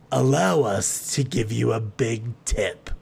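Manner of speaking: slow, affected character voice; grand Theodora character. A narrator's reading. Theodora offering Creed a tip, slow and affected.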